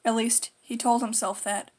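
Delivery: usual speaking voice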